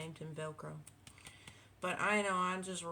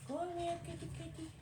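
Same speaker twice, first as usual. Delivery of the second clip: much higher coaxing pet call; calling the cat; 11 semitones higher than usual. Real, not acted. Calling her cat over to the camera, high coaxing call.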